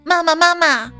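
usual speaking voice

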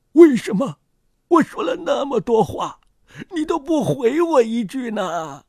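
put-on high, lamenting old-man voice; plaintive old man. Lonely old man to his silent flower, plaintive. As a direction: complain high-pitched, pleading for an answer.

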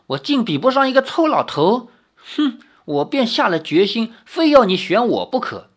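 high, petulant complaint; indignant, wilful woman's pout; piqued insistence. A narrator's reading. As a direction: huff and insist on being chosen. Woman recalls being piqued, insists.

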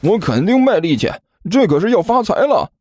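eager high-voiced sidekick; giggly eager disciple. A narrator's reading. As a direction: reassure the master in a high, cheeky voice. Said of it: "汪旭笑嘻嘻地说道".

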